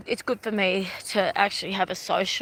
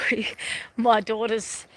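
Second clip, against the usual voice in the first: ordinary talk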